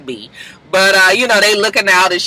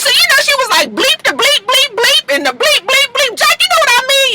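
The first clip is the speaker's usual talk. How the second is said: high Pooh impression with bleeps; very high and bouncy. Pooh telling Jack the bleeped details, very high voice. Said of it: "Pooh was just like".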